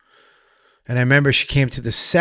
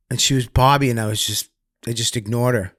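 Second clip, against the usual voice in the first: normal talking voice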